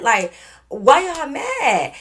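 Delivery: high, loud, drawn-out retold challenge; incredulous questioning. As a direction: stretch it out slowly in disbelief. Retold confrontation with Q.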